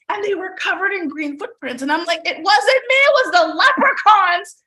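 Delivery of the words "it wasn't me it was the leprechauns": put-on a kid insisting it wasn't her; child's innocent denial. Child denying blame to teacher.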